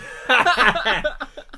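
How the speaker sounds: high, loud burst of laughter. Real, not acted. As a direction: break into a big laugh.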